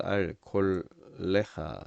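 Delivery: 콜레하 slowly, one syllable at a time; syllable-by-syllable demo. Shown as a demonstration. Teacher showing students how the syllables go, 콜레하 sounded out slowly. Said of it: "음절이 이렇게 발음이 되죠".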